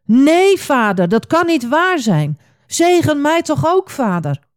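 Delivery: high, loud outburst of protest; storybook fury, pitched high; furious cry. A narrator's reading. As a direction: cry out in outraged pleading. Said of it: "Esau schreeuwde het uit. Hij was woest".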